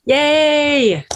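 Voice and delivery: high excited yay; cheer. Real, not acted. Producer cheering the table read, excited yay. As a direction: cheer the readers on.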